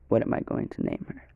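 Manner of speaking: whispered question to himself; low unvoiced aside. Real, not acted. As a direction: mutter it under your breath, voiceless. Thinking aloud to himself.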